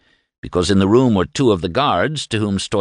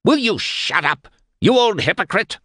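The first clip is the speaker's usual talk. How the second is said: screamed insult; 12 semitones higher than usual. A narrator's reading. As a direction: yell him down, full fury. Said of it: "Stoit screamed".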